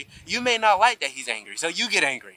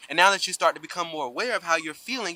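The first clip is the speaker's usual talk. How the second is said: ordinary delivery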